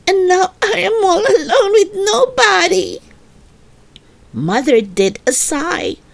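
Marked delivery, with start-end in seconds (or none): 0.1-3.0 much higher, whining, near tears; whiny wail. Acted. Little girl wailing to her mother that she is alone. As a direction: wail it like a heartbroken little kid.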